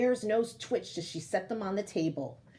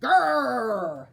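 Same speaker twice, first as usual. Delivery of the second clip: loud bear growl; 8 dB louder than usual. Acted. Bear answers loudly.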